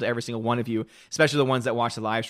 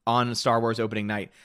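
usual speaking voice

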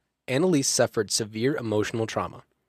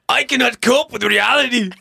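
high, loud mock German accent; comic shouted German accent; bad German accent; 12 semitones higher, 6 dB louder than usual. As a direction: cry it out in hammed-up German. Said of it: "Do a German accent right now".

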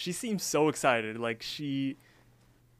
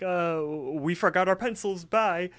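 higher, louder imitation of a hasty excuse; playing it off; 6 semitones higher, 3 dB louder than usual. Mordecai makes an excuse to Margaret and runs off, playing it off. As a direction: imitate someone stalling, then bailing out. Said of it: "tries to play it off".

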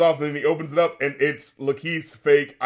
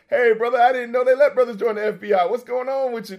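higher-pitched imitation of a friendly stranger; acted-out chummy greeting, pitched up; 7 semitones higher than usual. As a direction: greet him like an over-familiar brother.

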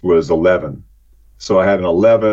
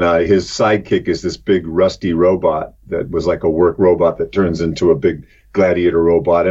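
normal talking voice

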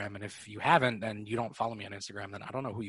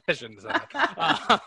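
slow, giggling sheepish admission; laughing apology; 7 semitones higher, 10 dB louder, slower than usual. Real, not acted. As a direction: laugh it off, a bit higher and louder. Embarrassed laugh after joke.

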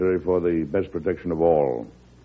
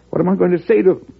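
higher, louder and faster, alarmed; anxious outburst; 10 semitones higher, 5 dB louder, faster than usual. Acted. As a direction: throw the question out in growing alarm. President repeats question, alarmed.

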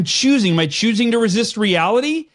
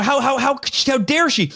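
higher-pitched mock indignation; retold stewing; 5 semitones higher than usual. Salesman cursing under his breath in his car, mock indignant. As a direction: sputter mildly, as if stewing to yourself. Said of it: "cursing under my breath, and I'm stewing".

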